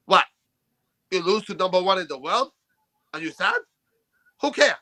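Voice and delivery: blunt mock Polish accent in short phrases. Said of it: "I like your Polish accent".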